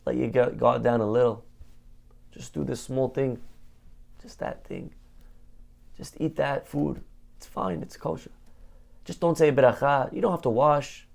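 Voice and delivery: very soft, sly little nudge; coaxing tempter. Acted. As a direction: tempt them gently, barely above a murmur. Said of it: "he comes slow".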